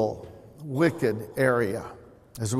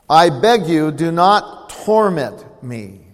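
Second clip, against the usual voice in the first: loud, slow begging demon; loud pleading; 7 dB louder, slower than usual. Acted. Demon begs Jesus loudly, slowly pleading. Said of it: "with a loud voice said".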